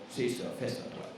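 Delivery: quiet sulky whatever; sulky dismissal. Acted. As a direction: shrug it off like a pouting kid. Jonas sulking dismissively.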